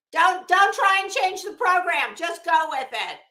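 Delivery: teasing lecture to a chat regular; mock scolding. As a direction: tell Mike off in jest. Mock scolding Mike on program.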